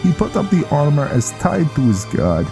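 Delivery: Viking defending himself. Acted. Viking promising Velen the armor is safe, animated.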